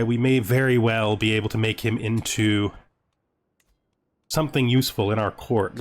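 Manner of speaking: ordinary delivery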